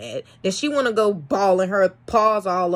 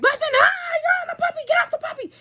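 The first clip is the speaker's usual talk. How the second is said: very high, fast, alarmed scolding; acted frantic yell; much faster than usual. Owner ordering the dog off a puppy, frantic yell. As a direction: re-enact a panicked order in a squealing voice.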